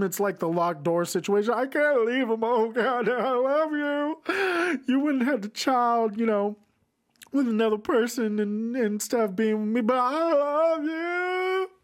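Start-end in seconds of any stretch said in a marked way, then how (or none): 1.5-11.7 mock tearful plea. Mocking the heroine crying over her lover. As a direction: ham up a sobbing lover's farewell.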